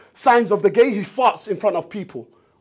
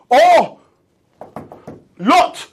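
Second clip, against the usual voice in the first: higher, louder, hammed-up mob yelling at the door; mob calling out; 7 semitones higher, 5 dB louder, slower than usual. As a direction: play the rowdy crowd banging on Lot's door, laughing at the end. The mob shouting at Lot's door.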